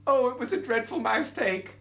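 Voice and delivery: high, pleading little mouse; squeaky mouse voice. Acted.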